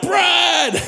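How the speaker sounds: high, comic holler of a name; imitated yell. Aussie lady startling the pastor at a meeting, imitated yell. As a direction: yell it out like a lady across the room. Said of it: "Aussie lady yells out".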